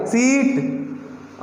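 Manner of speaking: सीट with the ee held very long; long sound demo. Shown as a demonstration. Teacher drawing out the long vowel, very slow. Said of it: "पूरा जो शरीर होगा आपका कंपन करेगा".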